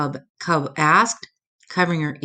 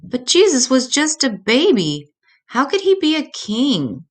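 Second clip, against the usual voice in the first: little cub voice; 9 semitones higher, 3 dB louder than usual. Acted. Cub asks mama, child voice.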